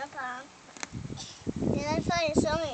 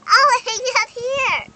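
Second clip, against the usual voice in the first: screaming at a spider; 6 semitones higher, 13 dB louder, slower than usual. Real, not acted. Child backing away from spider.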